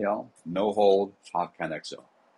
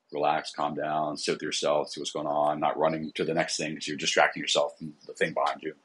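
ordinary talk

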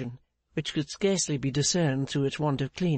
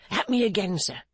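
higher-pitched, urgent exclamation; exclaiming; 5 semitones higher than usual. A narrator's reading. Pancks inviting further reproach from Clennam, urgent exclamation. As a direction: exclaim it, demanding more blame. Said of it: "exclaimed Pancks".

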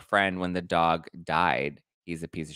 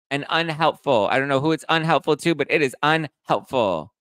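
high, loud, indignant mock defense; mock outraged scolding; 5 semitones higher, 5 dB louder than usual. Live host scolding a chat commenter in a mock-indignant voice. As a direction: ham up a scandalized scold.

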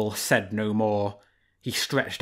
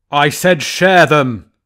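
much louder, slow royal fury; angry roar; 3 semitones higher, 12 dB louder, slower than usual. A narrator's reading. Lion king furiously repeating his order. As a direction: roar the command.

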